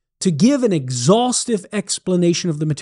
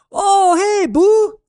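much higher, slightly louder Cajun greeting; Cajun holler back; 5 dB louder, slower than usual. Acted. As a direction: holler a cheery Cajun hello from the rice field. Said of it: "Thibodeau hollers back".

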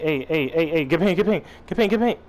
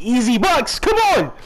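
hyped shout; 8 semitones higher, 4 dB louder than usual. Real, not acted. Hyped celebrating bucket.

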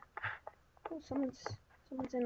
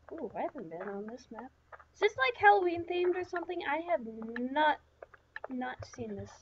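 normal talking voice

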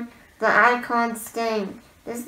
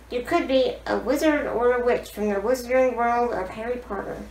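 normal talking voice

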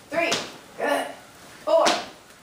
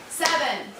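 ordinary talk, nothing marked

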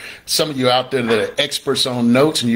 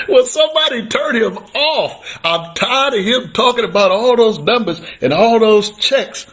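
a hammed-up complaining onlooker, pitched way up; mocking fed-up viewer; 9 semitones higher than usual. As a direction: lift the pitch and whine like a heckler tired of him. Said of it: "people that's still looking at me going".

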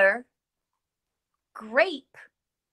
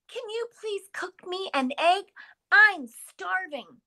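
lightly voiced begging friend, higher; hungry Carolyn; 9 semitones higher than usual. Carolyn asks the teacher for an egg in a lightly whiny voice. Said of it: "Carolyn might say".

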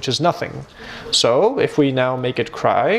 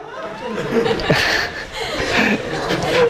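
airy fit of laughter from a student's joke; a silent, shaking laugh; breathless laugh; 4 semitones higher than usual. Real, not acted. Teacher cracks up at a student's joke, breathless laughing.

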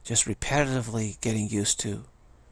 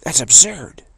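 loud, scornful snap, higher; snappy harpy voice; 6 semitones higher, 13 dB louder than usual. Retold or imitated. Inner voice dismissing beauty, loud scornful harpy snap. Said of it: "that voice said".

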